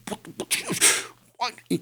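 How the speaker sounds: soft, breath-only sputter going high; imitated frantic whisper. Hunter mimics his buddy filming in the tree, frantic whisper.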